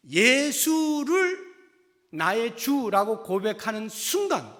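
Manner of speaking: high, loud, drawn-out sermon climax; forceful preaching. Real, not acted. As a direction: preach it out loud, stretching the key words.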